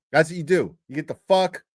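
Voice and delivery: usual speaking voice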